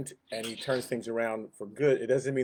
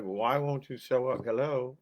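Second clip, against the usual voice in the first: impatient angel. Retold or imitated. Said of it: "angel's been waiting there for, like, a week".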